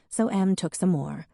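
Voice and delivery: plain reading voice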